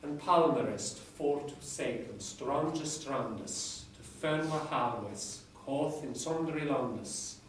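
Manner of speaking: reading Chaucer aloud in Middle English pronunciation. Shown as a demonstration. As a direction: recite it like a lecturer reviving Chaucer's English. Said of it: "I can't pronounce it as well as my lecturer in Middle English, but I'll give it a go".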